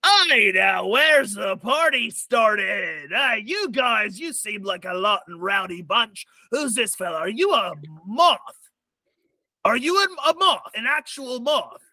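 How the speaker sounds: high, swaggering pirate voice; swashbuckling gnome pirate. Acted. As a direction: swagger in like you own the place. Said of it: "gnome pirate-looking fella ... says".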